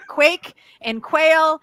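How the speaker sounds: ordinary delivery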